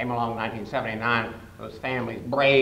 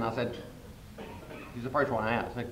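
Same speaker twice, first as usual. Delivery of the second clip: normal talking voice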